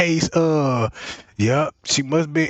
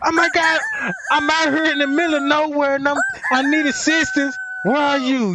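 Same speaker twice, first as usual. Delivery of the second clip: panicked caller; 7 semitones higher than usual. Retold or imitated. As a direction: beg for help like a lost caller. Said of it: "they gonna hear this automatic".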